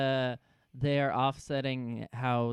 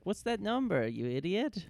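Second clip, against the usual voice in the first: mock Saeed; 9 semitones higher than usual. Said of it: "Saeed's like".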